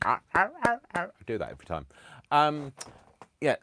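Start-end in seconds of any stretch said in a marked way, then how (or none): 0.1-1.0 high, loud seal barks; imitated seal bark. Clapping for a thrown fish, mock seal barking.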